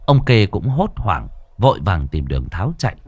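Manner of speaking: plain reading voice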